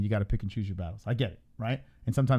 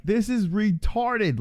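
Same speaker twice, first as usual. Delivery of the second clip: slow high drawn-out quote; 10 semitones higher, much slower than usual. Retold or imitated. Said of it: "I'm laughing 'cause I'm like".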